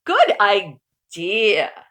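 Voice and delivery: slow, loud drawn-out good idea; hearty refrain. Acted. Uncle Murray's catchphrase agreement, slow and loud. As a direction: savour it like a catchphrase.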